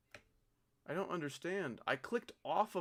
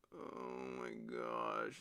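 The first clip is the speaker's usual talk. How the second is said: low, soft, slow despairing groan; worn-out player moaning after dying; defeated groan; 9 dB quieter, much slower than usual. Real, not acted. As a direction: sink into it, beaten. Defeated sigh after another death.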